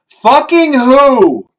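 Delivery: loud, slow, high yell from the next room. Pretend. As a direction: shout it through the wall in disbelief. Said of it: "you hear your sister say".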